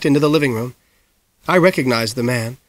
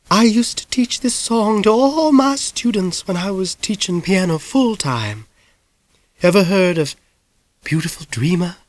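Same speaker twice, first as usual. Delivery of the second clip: raised, prim piano teacher's voice; Miss Blueglass voice; 6 semitones higher than usual. A narrator's reading. Miss Blueglass, to Ben, in her genteel lady voice. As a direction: lift it into a genteel Southern lady.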